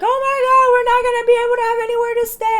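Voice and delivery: high, loud, rushed mock wail; Mimi crying. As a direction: imitate a friend's tearful panic, shrieked fast. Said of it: "My friend Mimi starts crying".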